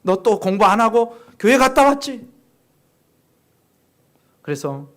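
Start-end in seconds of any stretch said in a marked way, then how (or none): 0.0-2.3 높고 크게 다그치는 흉내; 고모처럼 목청 높여 추궁하듯; 윽박지르는 고모. Retold or imitated. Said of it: "고모가 또 윽박지르고 잘못했다고 또 야단치고". (크게 야단치는 흉내)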